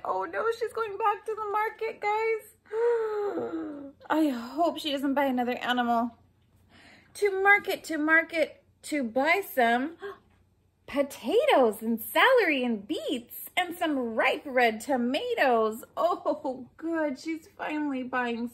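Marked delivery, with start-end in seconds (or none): 2.7-4.1 dramatic gasp. Acted. Reading to kids, mock-horrified gasp. Gasp at her going back. 7.1-15.8 bouncy rhyme, rising on market; sing-song rhyme reading. A narrator's reading. Reading a rhyme aloud to children, sing-song.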